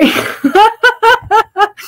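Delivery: high bursting laugh; a real laugh breaking out. Real, not acted. Laughing at her own goodbye joke, high burst.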